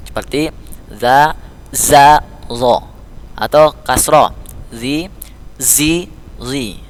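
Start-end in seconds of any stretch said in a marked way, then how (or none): 1.0-3.0 "za, za, zo": small tongue-tip, buzzed, then heavy rounded; contrasting z letters. Shown as a demonstration. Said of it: "ini lebih besar ditekankan". 4.7-6.8 "zi, zi, zi": tongue-tip, buzzed, then heavy; held "zi, zi, zi", each z distinct; contrasting z letters. Shown as a demonstration. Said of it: "beda kan?".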